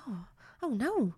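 soft, mock-scared little "oh no"; hushed pretend dismay; shaking Noddy. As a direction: imitate a toy quaking quietly. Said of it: "shaking up".

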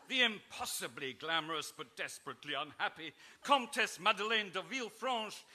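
ordinary delivery

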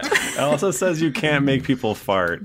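reading out through a laugh. Real, not acted. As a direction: chuckle through the rule. Teasing rule reading.

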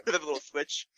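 normal talking voice

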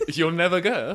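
high, laughing words. Real, not acted. Quizmaster cracking up at the accent answer, laughing. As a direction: say it through giggles.

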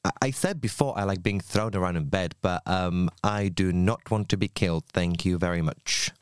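ordinary delivery

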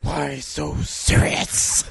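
drawn-out, whispery villain impression; famous Batman villain. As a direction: stretch each word slowly into a rasping whisper. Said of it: "[name] is a great impersonation of it".

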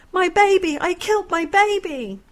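high, loud grieving cry; anguished wail. Acted. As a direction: wail over what you did. Mother stabs her own son.

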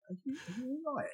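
high, soft, hesitant check; tentative concern. Retold or imitated. Husband imitating his wife checking on him, tentatively concerned. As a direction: ask gingerly in a small high voice.

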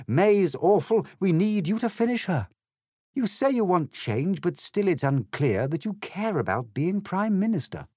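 high, pleading despair; anguished. A narrator's reading. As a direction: beg him in sincere anguish. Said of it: "with anguish sincere".

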